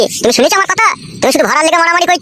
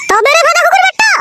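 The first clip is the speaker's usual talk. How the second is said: high-pitched battle cry; angry attack; 8 semitones higher than usual. Acted. Wife sets upon husband for insulting her mother, high angry cry. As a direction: lunge in with a high, furious insult.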